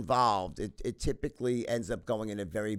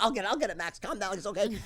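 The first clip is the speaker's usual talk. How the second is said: higher, hurried soothing mother; mom calming dad; 8 semitones higher, faster than usual. Retold or imitated. Mother rushing to calm Max, mildly voiced. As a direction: rush to calm an angry husband.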